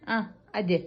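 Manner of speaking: normal talking voice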